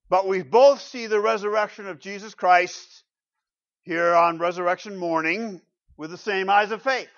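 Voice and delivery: loud, ringing pulpit proclamation; preaching at full force. Acted. Preacher to congregation, loud ringing proclamation. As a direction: proclaim it to the rafters.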